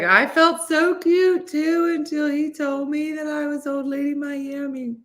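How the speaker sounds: lightly voiced high, hurt Missy; mock wounded pride, slow at first; a hint of a deflated friend; Missy quoted. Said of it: "Missus like".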